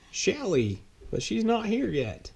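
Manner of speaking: a prim supervisor replying lightly; Miss Greenberg. Acted. Supervisor answers Chad.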